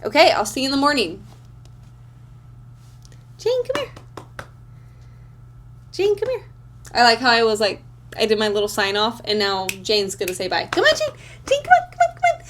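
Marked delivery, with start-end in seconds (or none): 3.4-6.4 high sing-song call to a pet; calling the dog. Real, not acted. Calling her dog Jane over, high sing-song call. 10.7-12.4 very high, eager pet-talk call; sweet high coaxing for a puppy; coaxing the dog. Real, not acted. Coaxing her dog Jane toward the camera, very high pet talk.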